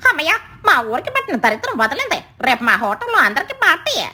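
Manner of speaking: high, swooping jubilant voice; comic gleeful relief; relieved bird friend. Acted. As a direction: celebrate with a sing-song whoop. Bird cheers the crow's change.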